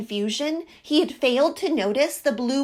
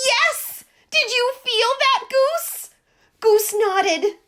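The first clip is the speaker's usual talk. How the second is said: much higher, loud, near shrieking; excited squawk; 13 semitones higher, 8 dB louder than usual. A narrator's reading. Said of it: "Duck squawked".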